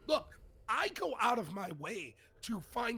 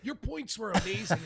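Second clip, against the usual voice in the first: mock agreement; 2 semitones higher than usual. Host bluffing to an unheard caller, mock-enthusiastic. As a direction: bluff your way through praising the caller. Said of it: "see how good of a B S".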